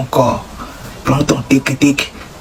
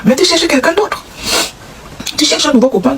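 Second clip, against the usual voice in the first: high mock voice; 9 semitones higher than usual. Voicing the man.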